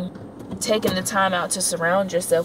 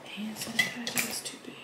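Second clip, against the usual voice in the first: barely audible; 10 dB quieter than usual. Real, not acted. Keeps voice low late at night.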